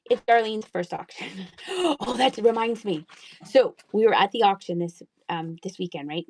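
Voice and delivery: usual speaking voice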